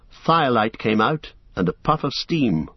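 ordinary delivery

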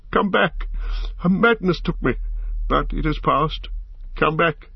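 high-pitched, remorseful plea; pleading call. A narrator's reading. Boromir begs Frodo to come back, high pleading call. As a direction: beg them to return, keeping the pitch high. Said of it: "he called".

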